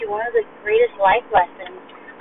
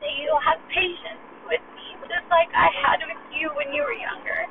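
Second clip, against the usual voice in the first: voice breaking; 7 semitones higher, 5 dB quieter than usual. Real, not acted. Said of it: "sorry to get emotional".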